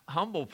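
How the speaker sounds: "humble" with the H dropped, UMBLE. Shown as a demonstration. Preacher explaining the word's origin, "humble" without an H. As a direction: start "humble" straight on the vowel, UM-bul. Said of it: "without an H on it".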